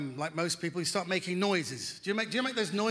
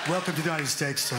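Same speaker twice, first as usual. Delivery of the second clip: mock official shout; 5 semitones lower than usual. Border officer greeting, shout heard.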